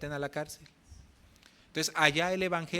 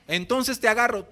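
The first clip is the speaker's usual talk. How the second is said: louder decisive pastor; firm pastor; 7 semitones higher, 10 dB louder than usual. Retold or imitated. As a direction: settle it with a firm grip. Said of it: "pastor replies".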